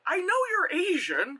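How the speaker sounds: high-pitched imagined inner voice; puzzled cashier. Retold or imitated.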